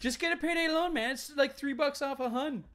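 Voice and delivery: high, loud, pushy TV ad pitchman; cheesy commercial voice. Retold or imitated. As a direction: sell it like a cheap TV ad. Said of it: "Remember that frickin' commercial?".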